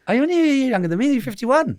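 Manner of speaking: high, quick, incredulous question; real surprise. Real, not acted. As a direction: blurt it out in amazed disbelief. Learns listener's age, amazed.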